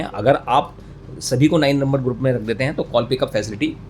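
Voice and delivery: ordinary delivery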